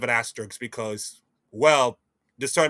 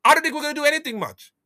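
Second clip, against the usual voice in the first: dismissive; 11 semitones higher, much faster than usual. Real, not acted. Fast high dismissive remark.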